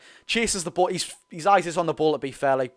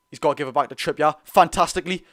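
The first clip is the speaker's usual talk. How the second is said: ordinary talk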